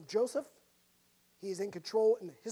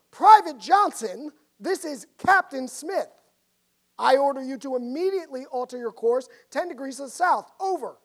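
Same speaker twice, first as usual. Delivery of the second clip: louder, commanding shout over the radio; captain shouting; 4 semitones higher, 9 dB louder than usual. Acted. Captain shouting at the private over the radio. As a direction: bark the order down the radio. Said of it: "the captain shouted back over the radio".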